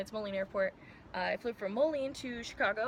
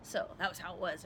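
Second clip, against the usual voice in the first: usual speaking voice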